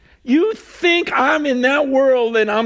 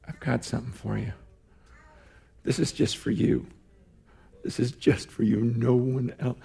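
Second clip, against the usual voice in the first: intimate hushed promise; 10 semitones lower, 9 dB quieter, faster than usual. Retold or imitated. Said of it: "he comes to you and says".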